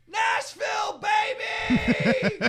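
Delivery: very high, full-out yell; retold drunken scream. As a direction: scream it like a wasted reveller. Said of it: "and he screams".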